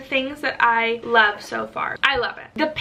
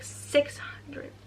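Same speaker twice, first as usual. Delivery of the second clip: whisper; 2 semitones higher, 10 dB quieter than usual. Real, not acted. Checking page count.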